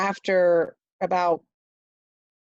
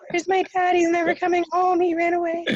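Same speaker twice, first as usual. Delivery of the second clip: high-pitched mock wail of a frightened child; imitated crying panic; 11 semitones higher than usual. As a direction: act out your panicking younger self, much higher. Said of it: "I just lose it and I'm crying".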